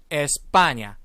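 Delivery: "에스파냐" loud and syllable-clear, stretched "파"; model pronunciation. Shown as a demonstration. Teacher asking class to repeat after her, model pronunciation. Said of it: "요 단어는 이렇게 읽습니다".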